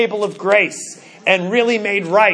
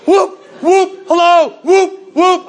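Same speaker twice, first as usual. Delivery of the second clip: high loud laughing whoops; whooping glee; 8 semitones higher, 5 dB louder, slower than usual. Acted. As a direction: whoop like a giddy schoolboy. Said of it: "brought a little Bruce in there".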